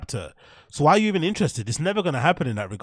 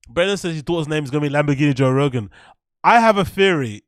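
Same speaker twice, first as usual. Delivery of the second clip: usual speaking voice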